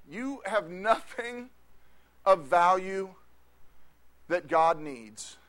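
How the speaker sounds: slow, deliberate preaching; slowed, weighty delivery; slow emphasis. Acted. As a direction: draw each word out. Sermon emphasis, slowing down.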